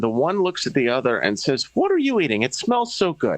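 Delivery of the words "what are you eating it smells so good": woman's voice. Retold or imitated.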